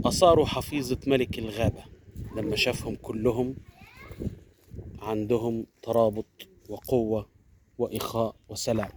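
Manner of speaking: ordinary delivery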